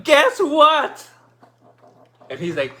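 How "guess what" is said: high, loud yell; excited yell. Retold or imitated. Winner calling a friend with big news, excited yell. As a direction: yell it down the phone, bursting with news. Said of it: "And then I yell at him".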